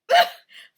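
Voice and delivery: sudden high, loud burst of laughter; a big amused laugh out loud; the farmer laughs at the small man; farmer's laugh. Acted. Farmer laughs at tiny Kui.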